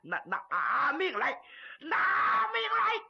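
high, drawn-out frenzied yell; stammered start building to a full-force high shout; stuttering battle shout. Acted. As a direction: scream the threat like a madman, stumbling on the first word. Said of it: "朱木英一边喊".

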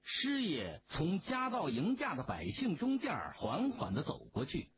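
ordinary delivery, nothing marked

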